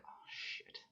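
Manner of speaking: whispered "oh shit"; thugs' hushed curse. Acted. Thugs caught by the guard, whispering. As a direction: caught red-handed, breathe it out. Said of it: "they're like".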